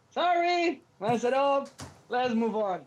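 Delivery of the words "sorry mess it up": ordinary talk, nothing marked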